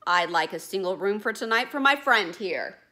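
loud, bold boatman at the desk. Acted. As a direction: stride up and demand a room. Boatman speaks in the story.

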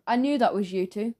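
usual speaking voice

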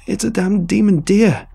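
high, loud panicked yell. Acted. Answering Huck while chased, terrified yell. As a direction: shout it out in terror. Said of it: "I yelled in answer".

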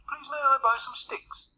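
high-pitched, polite piggy request; polite little pig. A narrator's reading. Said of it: "he asked politely".

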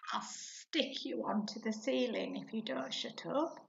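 ordinary talk, nothing marked

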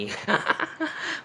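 high, breathy giggle; high burst of real laughter; amused laugh. Real, not acted. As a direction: laugh at your own sponsor joke. Laughs at having no sponsor.

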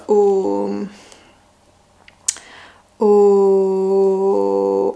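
normal talking voice

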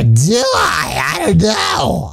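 hammed-up bewildered shout; playful shout. Real, not acted. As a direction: shout the confusion out. Shouting unsure.

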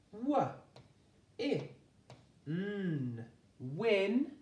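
W, I, n said apart and lifted, then "Win"; a phonics teacher sounding out "Win"; sounding out then blending. Shown as a demonstration. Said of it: "Sound this out with me".

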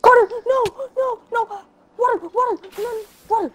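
high urgent panicked calls. Real, not acted. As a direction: urge him frantically to safety. Friend in danger, needs water.

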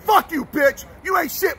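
usual speaking voice

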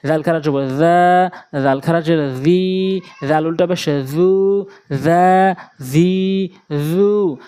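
slow spelling drill, জা, জি, জু held long; drawn-out long vowels. Shown as a demonstration. Teacher drilling attentive pupils, drawn-out vowels. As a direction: stretch জা, জি, জু on a level note. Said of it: "মনোযোগ সহকারে খেয়াল করি".

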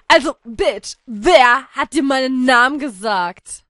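acted sassy demand. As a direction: demand it with attitude. Character demands answer, louder.

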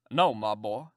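a hint of a low old gentleman; lightly voiced old uncle, welcoming. Old gentleman greeting the boy at the door, lightly voiced.

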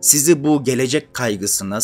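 ordinary talk, nothing marked

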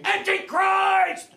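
drawn-out high mock scream of "Antichrist!". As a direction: howl it slowly like a man possessed. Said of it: "just screaming".

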